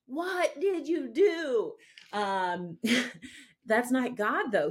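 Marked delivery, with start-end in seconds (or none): 0.1-1.7 slow, drawn-out accusing parent, much higher. Retold or imitated. Parent walking into the room catches a guilty child, slow accusing drawl. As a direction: stretch every word like a suspicious parent catching a kid. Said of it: "you walk into a room ... you're like".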